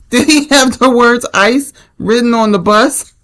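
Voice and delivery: high-pitched, breaking into laughter; real laughing. Real, not acted. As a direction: fight to get it out between real laughs. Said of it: "you see why I'm laughing".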